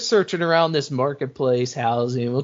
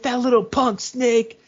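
pitched up, mildly angry jab; a hint of an angry cop, voiced higher; retold pissed-off insult; mock angry; 9 semitones higher than usual. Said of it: "He's pissed".

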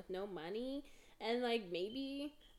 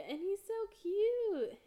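gushing; 10 semitones higher, 5 dB louder, slower than usual. Real, not acted. Gushing over Pokemon card.

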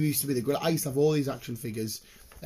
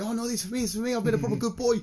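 put-on higher, sing-song little boy; childish boast; 8 semitones higher, faster than usual. To embarrass the till lad, a higher childish voice. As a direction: play the proud good little boy. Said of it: "I was just trying to embarrass him".

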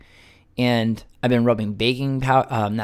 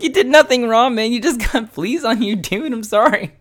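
high-pitched, half-laughing reassurance to a pet; amused apology; 11 semitones higher, 4 dB louder than usual. Retold or imitated. Man retelling apologising to his cat, amused. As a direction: apologise to the cat while cracking up.

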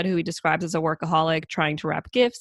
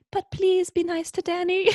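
imitating a whispery little brother, much higher pitched; Peter; 12 semitones higher than usual. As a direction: plead sweetly in a tiny high voice. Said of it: "always whispering and".

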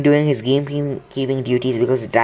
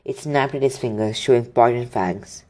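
ordinary delivery

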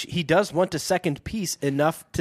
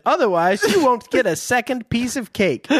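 high-pitched mock scolding; commanding; 9 semitones higher, 3 dB louder than usual. A narrator's reading. Narrator plays Iola threatening Joe, high mock command. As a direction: playfully issue a threat in a raised pitch. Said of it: "Iola commanded".

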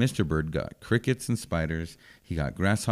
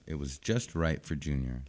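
ordinary delivery

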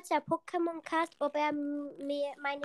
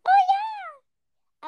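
eager shout; 10 dB louder than usual. Pretend. Eager yes to game.

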